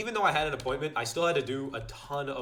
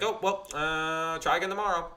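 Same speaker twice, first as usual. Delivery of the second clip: shrugging it off; 5 semitones higher than usual. Retold or imitated. Youtuber brushing off another missed video. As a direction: let it go lazily. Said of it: "I'm like".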